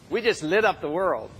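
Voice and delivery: ordinary delivery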